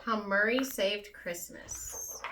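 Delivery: ordinary delivery, nothing marked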